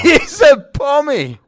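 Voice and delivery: very high, drawn-out shout; loud, stretched-out astonishment; incredulous shout. Real, not acted. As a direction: yell the punchline in disbelief. Repeats the incredible punchline loudly.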